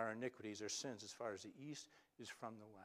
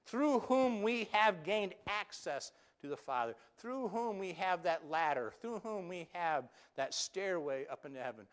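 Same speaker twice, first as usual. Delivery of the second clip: much higher and louder, rising fervor; preaching at full force; 6 semitones higher, 10 dB louder than usual. Acted. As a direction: build to the climax. Climax of sermon.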